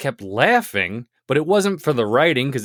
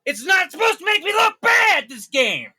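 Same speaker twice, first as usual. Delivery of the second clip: angry shout. Acted. Boss yelling at his cohost over the game. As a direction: blow up like a spoiled boss.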